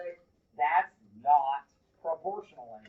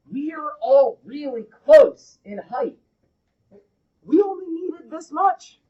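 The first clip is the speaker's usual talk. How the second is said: emphatic; 9 semitones higher, 13 dB louder than usual. Real, not acted. Emphatic teaching explanation.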